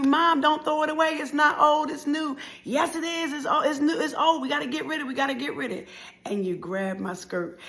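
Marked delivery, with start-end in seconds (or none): 0.0-2.4 high, loud protest to mom; insistent, high-pitched objection; child's protest. Acted. 2.6-5.9 high, rapid-fire arguing back; acted quarrelling mother; insistent mother.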